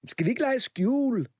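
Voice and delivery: high eager call; rally the party. A narrator's reading. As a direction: Kamma calling to the guests. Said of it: "råbte Kamma".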